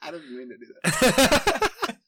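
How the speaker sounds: loud slow laughing apology; words squeezed out through laughter. Real, not acted. Laughing fit continues.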